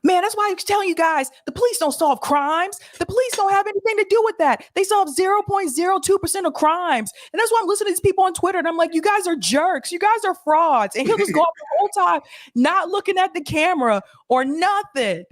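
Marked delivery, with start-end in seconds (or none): 0.0-11.0 excited rant. Retold or imitated. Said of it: "when Nick start getting excited".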